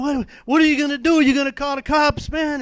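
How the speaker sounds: put-on a cornered kid begging to know his fate; panicked pleading. Scared kid pleading with captor.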